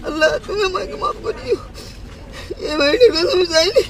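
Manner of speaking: real weeping, crying out to God. Real, not acted. Old man sobs, pleading to Allah.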